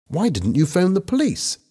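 high, surprised question; a teacher caught off guard by the news; startled query. A narrator's reading.

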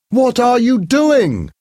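high, loud and slow; alarmed shout. A narrator's reading. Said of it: "Hester shouted".

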